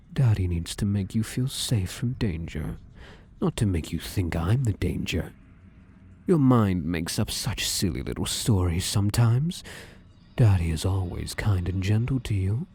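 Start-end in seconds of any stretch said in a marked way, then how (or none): none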